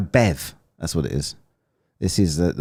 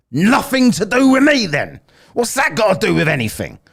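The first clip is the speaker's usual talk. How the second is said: much higher, louder exasperated comeback; indignant retort; 13 semitones higher, 6 dB louder than usual. Retold or imitated. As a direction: fire back in mock outrage. Retorting to American about Irish roots.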